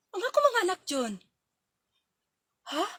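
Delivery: usual speaking voice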